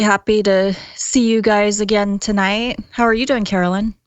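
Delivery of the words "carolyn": normal talking voice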